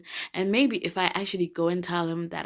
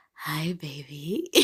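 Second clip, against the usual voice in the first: flirty greeting; 2 semitones lower, 4 dB quieter, much slower than usual. Real, not acted. Flirting at crush.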